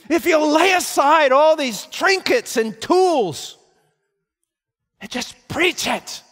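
loud, high preaching shout; ringing pulpit shout, slowing on key words; full force preaching. Acted. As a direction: thunder it from the pulpit.

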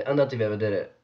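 usual speaking voice

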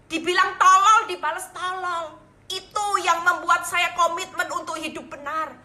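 high, loud, impassioned sermon; full-voiced proclamation to a congregation; fervent preaching. Real, not acted. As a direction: preach with rising force and long stresses.

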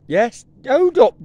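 high slow call. Real, not acted. Calling to horse, high slow.

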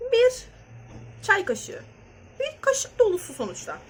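bir punched high and loud; stress on bir. Real, not acted. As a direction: hit the word bir hard. Showing the spoon, stressing bir.

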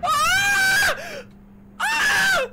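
very high, loud screams; terrified scream. Real, not acted. Player trapped with someone in there, terrified scream. Frightened in horror game.

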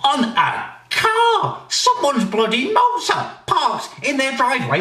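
high-pitched comic shock; shocked disbelief. Acted. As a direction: stretch it out in outraged disbelief. Outraged reaction to news clip.

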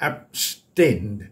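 "abstained" sounded out as ab... STAINED; pronunciation demo. Shown as a demonstration. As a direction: say "abstained" slowly in two parts, for learners. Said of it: "ออกเสียงว่า". (ออกเสียง "abstained" ช้า ๆ แยกพยางค์ให้ชัด)

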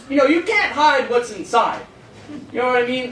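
usual speaking voice

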